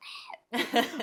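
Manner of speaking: short rising self-conscious laugh; embarrassed laugh. Real, not acted. Laughing at her younger self, embarrassed. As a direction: laugh at your own past.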